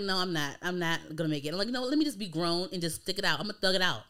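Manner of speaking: usual speaking voice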